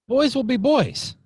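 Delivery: put-on high, loud Italian-accented father. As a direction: protest loudly in a light Italian accent. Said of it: "kinda Italian accent".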